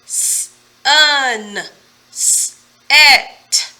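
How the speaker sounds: each syllable broken into its sounds, said loud; sounds split apart. Shown as a demonstration. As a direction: segment s-un, s-et slowly and clearly. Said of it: "And the sounds in each syllable?".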